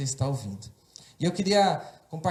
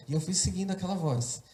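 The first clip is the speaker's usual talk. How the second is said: usual speaking voice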